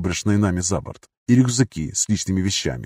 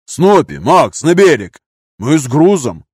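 loud, raised, urgent calls; shouted orders; 5 semitones higher, 7 dB louder, slower than usual. A narrator's reading. Fighters calling as boat approaches, urgent shouts. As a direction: call commands across the deck.

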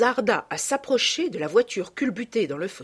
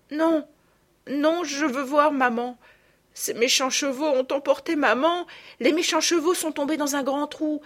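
put-on upset little girl; 7 semitones higher than usual. A narrator's reading. Little girl cries for mother.

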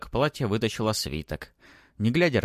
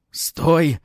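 loud, high strained command; hoarse shout; 9 semitones higher, 8 dB louder than usual. A narrator's reading. Baron chases the boy, shouting hoarsely. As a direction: yell hoarsely after a fugitive. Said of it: "хрипел барон".

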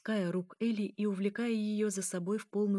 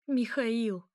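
higher imploring call of his name; pleading; 4 semitones higher, 4 dB louder than usual. A narrator's reading. Elya implores Mikhail, anger gone, pleading. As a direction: call out to him, begging. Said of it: "только мольба".

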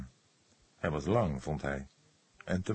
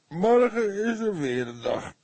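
slow, drawn-out, higher tired sigh of a line; weary groan; 14 semitones higher, 7 dB louder than usual. A narrator's reading. Cook ends a long night, weary drawl. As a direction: stretch it out wearily. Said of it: "kreunde hij".

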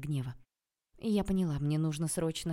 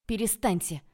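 louder, higher protest; exclaimed cry; 4 semitones higher, 6 dB louder than usual. A narrator's reading. Woman calling out to stop the quarrel, exclaiming. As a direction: call out sharply to stop them. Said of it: "выкрикнула я".

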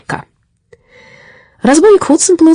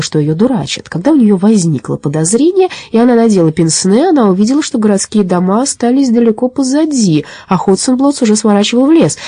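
ordinary delivery

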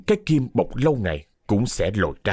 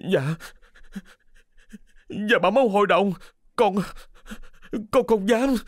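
halting, high-pitched servant's plea; terrified, cowering denial; frightened stammer; 10 semitones higher than usual. A narrator's reading. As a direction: stammer fearfully in broken pieces. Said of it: "sợ hãi quỳ rạp xuống đất, mặt tái mét, lắp bắp".

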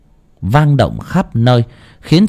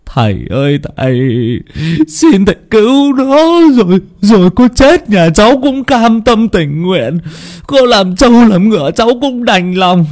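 tearful pleading; 11 semitones higher than usual. A narrator's reading. Distraught father begs the shaman to save his son, tearful wailing. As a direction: beg desperately as if bawling. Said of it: "cứ ôm lấy con mà mếu máo".

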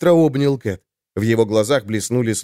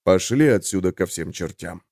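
indifferent; 7 semitones lower than usual. A narrator's reading. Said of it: "равнодушно отвернулся и сказал товарищам".

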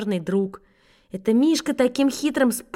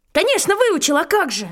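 high-pitched, eager false confidence; sudden bluff; 6 semitones higher than usual. Acted. Schoolboy lying to his teacher about homework, in breezy high-pitched assurance. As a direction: blurt it out as if perfectly sure. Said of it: "вдруг неожиданно даже для самого себя сказал".

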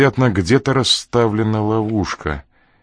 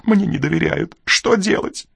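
high, anxious wavering voice; voice faltering; 9 semitones higher than usual. A narrator's reading. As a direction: plead nervously, pitched well up. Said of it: "Его голос дрогнул".

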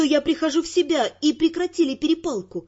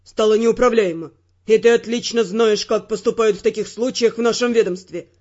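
low male voice, hard and stern; harsh Ferrant; 5 semitones lower, 3 dB louder than usual. Acted.

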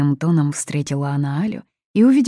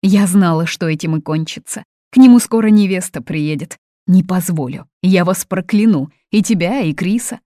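loud, raised, nervous protest; agitated; 4 semitones higher, 9 dB louder than usual. A narrator's reading. Mother threatens to curse son and guest, agitated. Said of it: "занервничала Фатини".

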